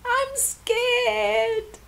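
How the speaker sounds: put-on high, small frightened child voice; a child at her parents' bed in the dark; scared little girl. A narrator's reading. Sanele at her parents' bed at night, small and scared.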